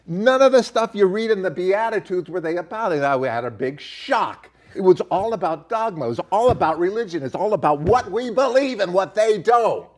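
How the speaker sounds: rising fervent sermon voice; preaching. Real, not acted. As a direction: build it like a pulpit sermon. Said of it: "Do I sound like a preacher there?".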